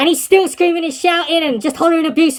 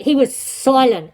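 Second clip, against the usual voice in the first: ordinary delivery, nothing marked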